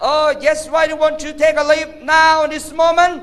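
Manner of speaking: high, drawn-out put-on voice; slow, hammed-up interrogation of a pupil; mock teacher questioning. Teacher asking student why leave now, high theatrical voice.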